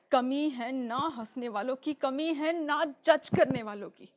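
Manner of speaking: loud, deliberate, hammering each phrase; impassioned insistence. Acted. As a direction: drive the answer home, slowly and forcefully. Impassioned answer to the judgments.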